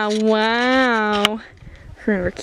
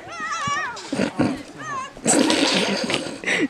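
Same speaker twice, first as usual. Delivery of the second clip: laughing hard; 5 dB quieter than usual. Real, not acted. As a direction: break into laughter at the fall. Friend falls while filmed.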